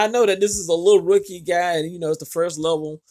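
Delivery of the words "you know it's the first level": ordinary delivery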